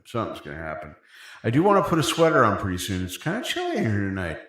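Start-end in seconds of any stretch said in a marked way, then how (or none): 0.0-0.9 much softer, lower, mock-mysterious; ominous hush. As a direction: hush it like a teaser. Repeats it, teasing suspense.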